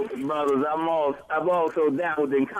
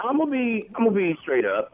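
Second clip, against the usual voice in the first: usual speaking voice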